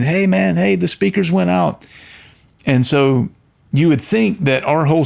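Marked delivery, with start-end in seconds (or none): 0.0-1.9 higher, calling down to the engineer; retold yell. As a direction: shout it up at the sound guy over the music. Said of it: "And so we're yelling".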